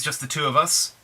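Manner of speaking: ordinary talk, nothing marked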